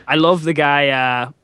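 ordinary delivery

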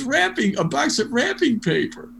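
high-pitched playful imitation; joking mock delight. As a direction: act thrilled by a joke present. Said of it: "he just jokes all the time".

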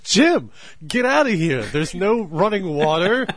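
loud, high, outraged yelling; exasperated yelling. Pretend. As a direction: yell it at an intruding friend. Answering Jim's handshake in the bathroom.

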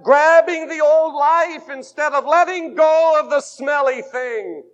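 fervent revival preacher; preaching at full force. Acted. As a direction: drive the point home.

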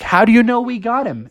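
high, questioning little character; high-voiced curious kid; Froggy. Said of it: "Froggy was like".

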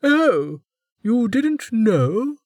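high, slow mock surprise; surprised warden. A narrator's reading. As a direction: savour his ignorance.

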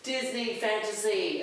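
greeting the family aboard in mock British; attempted British accent. Host welcomes family aboard in a put-on British accent. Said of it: "that accent that I was trying to do".

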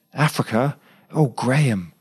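sudden high cry of dismay; impulsive cry. A narrator's reading. Claire, hearing he leaves for Africa, cries out high. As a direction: blurt it out, shaken and high. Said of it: "With an impulsive cry".

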